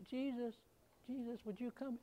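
faint, high little plea; barely calling out to Jesus; the wrong way to cry out, small and quiet; weak timid call. Shown as a demonstration. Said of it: "He was yelling".

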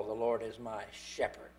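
low, soft phrase with "shepherd" set off at the end; hushed weight on the final "shepherd"; stress on SHEPHERD. Shown as a demonstration. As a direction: drop quiet and low, placing "shepherd" carefully. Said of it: "And then last of all".

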